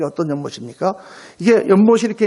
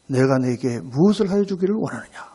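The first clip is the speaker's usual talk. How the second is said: low, measured, dignified question; solemn Jesus; 7 semitones lower than usual. Retold or imitated. Jesus asks the two blind men, solemn and low.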